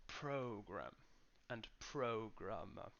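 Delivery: program and programmer stressed on PRO; first-syllable stress. Shown as a demonstration. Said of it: "is in the first syllable".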